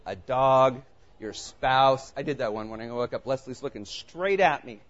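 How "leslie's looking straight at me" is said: normal talking voice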